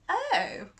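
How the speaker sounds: a high surprised oh; the jury reacts to the accusation with a high startled oh; exclaimed. A narrator's reading. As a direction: gasp it out in astonishment. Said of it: "exclaimed the jury".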